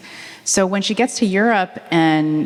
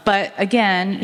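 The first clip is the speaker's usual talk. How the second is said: ordinary talk, nothing marked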